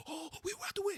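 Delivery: high hushed voice; quiet. Retold or imitated. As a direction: keep it down so the baby sleeps. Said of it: "He was being quiet".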